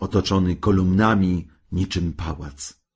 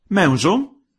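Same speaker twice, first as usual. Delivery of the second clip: high, loud summons; sharp rising address, pitched up; imperious wife; 11 semitones higher, 5 dB louder than usual. A narrator's reading. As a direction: call the husband to heel.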